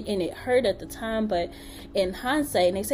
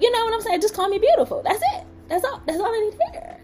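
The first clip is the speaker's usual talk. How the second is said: high-pitched mock insistence; high, comic pleading; playful pleading; 12 semitones higher, 4 dB louder, much faster than usual. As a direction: squeal it jokingly, high and laughing. Joking plea for compliments.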